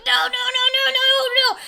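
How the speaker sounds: very high frantic string of noes; panicked mom. Retold or imitated. Mother in the elevator panicking as the baby comes. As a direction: squeal the noes in alarm. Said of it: "my mother's like".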